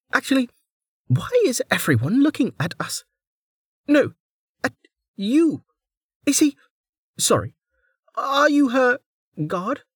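very high bubbly voice; a cheerful girl babbling apologies; chirpy young woman. A narrator's reading. Niriri apologising to Velika, bubbly and high.

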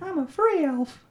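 high, slow, put-on little voice; Pinocchio-like elf. Imitating Dobby freed, high Pinocchio-like voice. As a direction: squeak it out slowly as a little elf. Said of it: "You sounded like Pinocchio".

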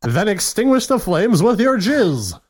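high, theatrical reading of a crude definition; hammed-up announcer read. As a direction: read it out like a showy presenter. Reads out joke definition.

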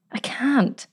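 breathless whispered refusal; scared whisper; frightened whisper. Acted. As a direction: barely dare to say it. Frightened girl refuses her aunt.

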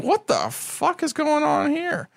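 high, slow, drawn-out disbelief; retold bafflement. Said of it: "I'm like".

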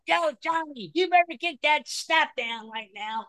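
put-on hollering at her kid from the sidelines; yelling sideline mom. Sideline mom yells at her son across the field. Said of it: "I hear this lady go out".

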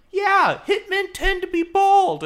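put-on high, loud excited kid voice; squeaky child quoting a fact. Kid on a bike quoted.

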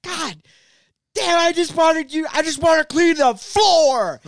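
high, loud, hammed-up frustrated yell; exasperated outburst. Dad snapping at his kids over cleaning, exasperated yell.

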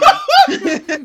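shrieking laugh; loud high laugh. Real, not acted. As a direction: burst out laughing. Laughing at own joke.

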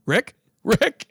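calling out. Retold or imitated. Calling after friend vanished underwater.

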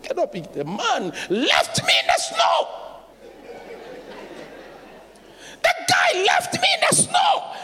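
high, loud shouted cry; preaching shout. Real, not acted. The preacher shouts to the church about being left in the snow.

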